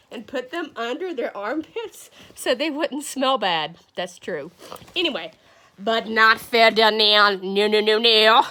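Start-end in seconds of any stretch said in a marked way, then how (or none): 5.8-8.5 lower, punchy attempt at a Spanish accent; botched Spanish accent. Teacher tells the class a bull story, hammy Spanish accent. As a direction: try Spanish, landing nearer French. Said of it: "I really wanna do a Spanish accent".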